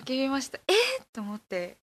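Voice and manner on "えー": high, loud surprised cry; excited shout. Retold or imitated. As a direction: yelp it out in delight. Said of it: "叫びましたよ".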